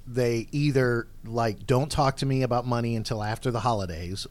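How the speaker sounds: ordinary delivery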